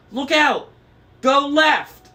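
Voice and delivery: very high, loud shriek; screech. A narrator's reading. Middle gray lady screeching at the driver. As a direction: scream the warning at full pitch.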